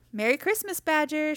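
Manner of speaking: high-pitched greeting called out; Rabbit's bright storybook shout; cheery shout. A narrator's reading. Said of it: "shouted Rabbit".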